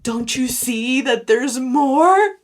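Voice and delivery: loud, slow, urgent plea; mock shaking someone awake, loud and slow; pleading shake. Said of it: "shake them and be like".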